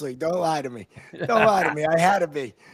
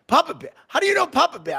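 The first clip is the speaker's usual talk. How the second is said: high, louder startled question; stunned surprise; 9 semitones higher, 5 dB louder, faster than usual. Retold or imitated. Player learns the ref knows his uncle, shocked. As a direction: react like the news floored you. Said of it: "your face completely changed".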